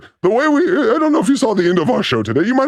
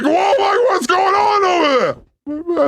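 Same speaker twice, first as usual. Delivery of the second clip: higher, drawn-out mock whoa; mock amazement; 6 semitones higher, slower than usual. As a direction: act stunned by the sight. Reacting to Mike's big look.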